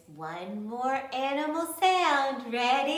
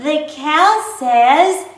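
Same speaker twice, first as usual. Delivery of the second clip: put-on singsong kids theatre; 4 semitones higher, 11 dB louder, much slower than usual. Prompting kids to guess.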